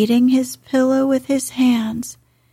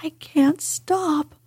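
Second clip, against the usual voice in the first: sobbing, breathless plea; gasping and sobbing; 6 semitones higher than usual. Acted. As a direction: gasp it out through sobs. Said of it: "he gasped and sobbed".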